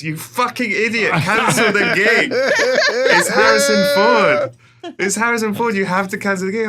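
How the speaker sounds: high, loud imitation of a furious colleague; exasperated yelling. Colleague berating him for keeping the gig, exasperated yelling. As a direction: yell it in comic outrage, stretching the key words.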